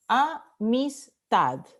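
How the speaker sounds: "Amistad" slowly split into a, mis, TAD; syllable by syllable. Shown as a demonstration. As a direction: sound out "Amistad" slowly, stressing the final TAD. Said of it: "Última sílaba".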